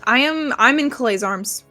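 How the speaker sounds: ordinary talk, nothing marked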